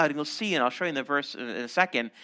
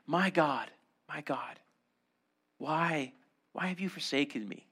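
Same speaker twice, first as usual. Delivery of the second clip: the Messiah on the cross, a quiet anguished cry; hushed anguished cry; 2 semitones lower, 7 dB quieter than usual. Acted. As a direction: let it fall away to almost nothing. Messiah's cry voiced softly.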